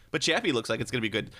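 usual speaking voice

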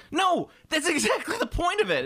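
very high-pitched, emphatic protest; exasperated rebuttal. Acted. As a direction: burst out in comic exasperation. Exasperated rebuttal to mad fans.

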